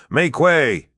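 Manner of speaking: high, loud bellowing call. A narrator's reading. De Verck riding through the camp, bellowing for way. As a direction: roar it over the battle noise. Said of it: "bellowed de Verck".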